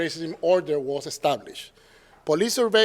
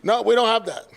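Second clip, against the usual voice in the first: mock realtor denial; 5 semitones higher, 4 dB louder than usual.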